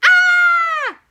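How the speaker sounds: shrill frightened scream; high loud fright scream. Acted. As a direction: scream at the wolf. Girl screams at wolf.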